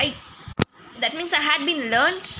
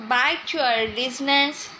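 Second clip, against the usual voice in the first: ordinary delivery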